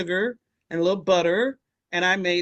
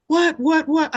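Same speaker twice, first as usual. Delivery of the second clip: high, louder stunned what; shocked old lady; 8 semitones higher, 6 dB louder than usual. Retold or imitated. Audrey hearing Tony refuse the oatmeal: high shocked outburst. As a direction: react in disbelief.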